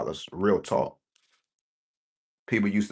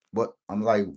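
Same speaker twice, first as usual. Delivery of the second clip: normal talking voice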